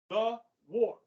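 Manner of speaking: "The", a pause, then "war" falling; separated words for emphasis. Shown as a demonstration. Teacher repeating Canada's name for the war, "The" and "war" separated. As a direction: set "The" and "war" apart, weightily.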